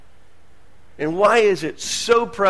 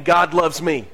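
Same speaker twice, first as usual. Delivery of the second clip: ordinary delivery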